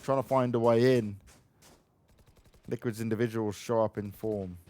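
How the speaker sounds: usual speaking voice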